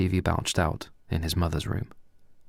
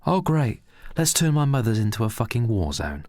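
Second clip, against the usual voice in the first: higher, exasperated sarcasm; stressed sarcasm; 4 semitones higher, 3 dB louder than usual. Acted. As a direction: groan it out sarcastically. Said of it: "said Pedro, stressfully".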